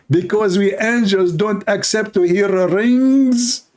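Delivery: high, slow, drawn-out announcing voice; angel's mock explanation. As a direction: explain it slowly as a lofty angel. Acting angel's haughty explanation.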